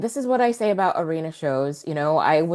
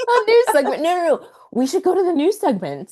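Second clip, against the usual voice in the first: much higher and louder, eager; excited; 10 semitones higher, 6 dB louder than usual. Real, not acted. Cohost demanding the news segment, high excited. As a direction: jump in gleefully with real excitement.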